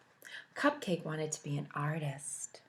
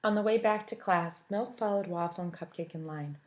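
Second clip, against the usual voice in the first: ordinary delivery, nothing marked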